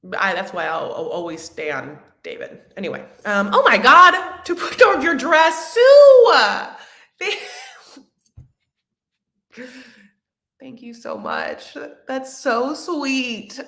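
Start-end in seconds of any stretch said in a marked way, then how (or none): 3.5-6.3 shrieking with delight, much louder and higher; excited shout. Real, not acted. Reading a viewer's comment aloud, shouting with excitement. 7.2-13.6 touched. Real, not acted. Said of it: "I'm a little emotional".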